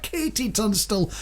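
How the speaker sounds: ordinary talk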